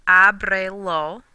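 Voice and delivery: "ábrelo" stretched very slowly, stress on á; "ábrelo" drawn out, Á-bre-lo; slow model word. Shown as a demonstration. Teacher models "ábrelo" slowly for students to try.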